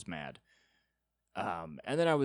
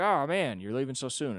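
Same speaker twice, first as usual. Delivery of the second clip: higher, louder "oh, man" easing off; imitated surprised letdown; 5 semitones higher, 6 dB louder than usual. Guy just met reacting to an early exit, imitated letdown. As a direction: act out a let-down acquaintance.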